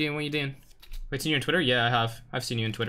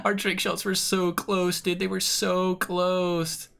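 slow, high, drawn-out mock whine; hammed-up grief over a missed shot; mock lament; 6 semitones higher, much slower than usual. Mourning lost trick shot.

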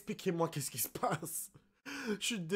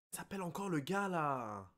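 normal talking voice

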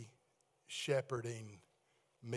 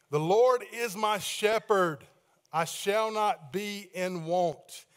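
louder, slow, emphatic declaration; proclaiming; 2 semitones higher, 7 dB louder, slower than usual. Acted. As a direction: announce the verse like a banner. Proclaims the verse to the church.

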